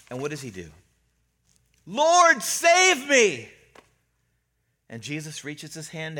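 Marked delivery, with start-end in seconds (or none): 1.9-3.5 high, loud, drawn-out plea for rescue; terrified cry. Retold or imitated. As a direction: cry out as the sinking Peter. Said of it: "Terror comes over him".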